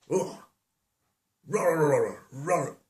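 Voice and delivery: high, dog-like roaring; bear roar. Acted. Storyteller imitating Daddy Bear coming in, high mock roaring. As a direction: roar like a bear but land nearer a barking dog. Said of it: "that's what dogs sound like".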